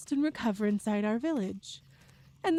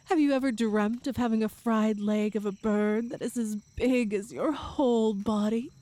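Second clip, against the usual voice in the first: ordinary delivery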